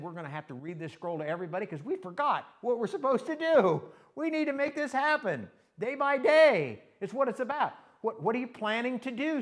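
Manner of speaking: high, loud, impassioned sermon peak; ringing pulpit exhortation; full-force preaching. Acted. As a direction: drive it home to the congregation.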